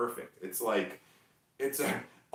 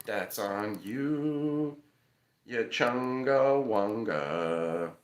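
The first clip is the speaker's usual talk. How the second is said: very slow, stretched-out syllables; goofy slow-motion tease; playful drawn-out taunt; much slower than usual. Pretend. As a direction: drag every word out teasingly.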